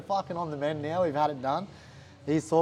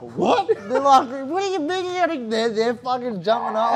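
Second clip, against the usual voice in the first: excited shouting; 7 semitones higher, 6 dB louder than usual. Retold or imitated. The boys react to contract news.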